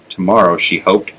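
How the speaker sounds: plain reading voice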